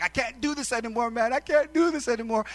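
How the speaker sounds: high, rising exasperated plea; mock exasperation. Retelling thoughts of quitting, high exasperated complaint. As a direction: act out being at the end of your rope. Retells wanting to quit his job.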